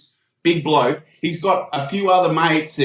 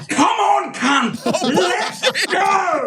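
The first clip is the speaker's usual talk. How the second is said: high mock shout laced with laughter; hammed-up high fight challenge; retold shout; 11 semitones higher, much slower than usual. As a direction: yell a dare to fight, much higher, half laughing. Naked Jake daring the bloke.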